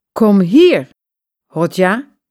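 luider, boos geroepen; boos roepen. A narrator's reading. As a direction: roep hem boos naar buiten. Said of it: "roept ze". (boze vrouw roept haar man)

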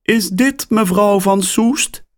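surprised caller on the line; woman's voice. A narrator's reading.

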